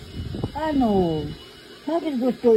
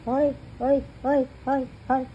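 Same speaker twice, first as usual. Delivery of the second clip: playful calling; 6 semitones higher than usual. Real, not acted. Calling out to the baby.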